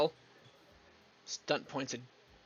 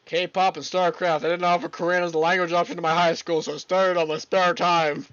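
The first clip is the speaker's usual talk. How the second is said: eager answer; 2 semitones higher, 9 dB louder than usual. Acted. Character proudly answering how he learned Korean, louder. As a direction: blurt out the hobbies proudly.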